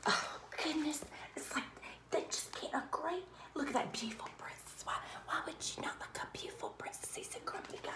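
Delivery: soft, quick, breathy whisper; whispered aside. Real, not acted. Mother whispering asides to her child during the story, hushed.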